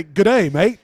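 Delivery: put-on loud Australian-style "Egyptian" accent; mock Egyptian accent. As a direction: badly fake Egyptian with an Aussie accent, loudly. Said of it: "That's how they fucking talk".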